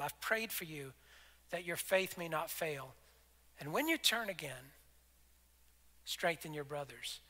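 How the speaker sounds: soft tender reassurance to a failing friend; tender hush. Acted. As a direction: speak it softly like a loving promise. Jesus' words repeated tenderly.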